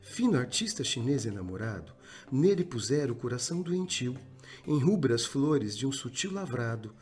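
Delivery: low, measured recitation of a sonnet; poem recital. Acted. Teacher reciting a sonnet to the class. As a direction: read it like a solemn poem.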